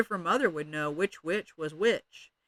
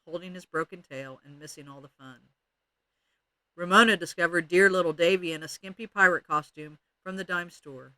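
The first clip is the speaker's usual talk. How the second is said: usual speaking voice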